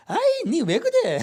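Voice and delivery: high, laughing mock brush-off; mock careless brush-off. Pastor mocking a careless brush-off to a hurting member. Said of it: "그렇게 말해야 될까?".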